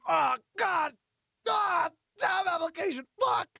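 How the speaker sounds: high, drawn-out anguished wail; hammed-up suffering cry; mock agony. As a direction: wail as if itching all over. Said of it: "Start itching".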